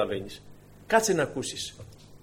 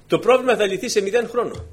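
usual speaking voice

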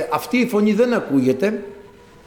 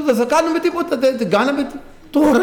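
high-pitched, incredulous patient; comically astonished retelling; retold bafflement; 10 semitones higher than usual. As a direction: act out baffled protest, pitch jumping up. Retold baffled patient after procedure.

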